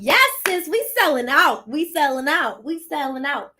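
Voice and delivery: high, loud hype chant; excited hype. Real, not acted. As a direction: hype the sale up like a cheer. Hyping herself up on live.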